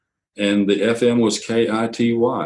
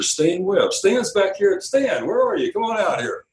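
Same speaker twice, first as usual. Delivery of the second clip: high-pitched mock emcee calling out a name; a hint of a host hailing him from the stage; 10 semitones higher than usual. Owner introduces his new sales manager to the crowd, high mock emcee call.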